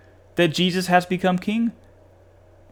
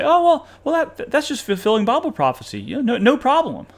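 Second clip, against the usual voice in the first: high-pitched dismissive mock voice; sarcastically breezy, hurried shrug-off; mock nonchalance; 8 semitones higher than usual. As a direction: brush it off as no big deal, mockingly. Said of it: "just have a straight face and just say".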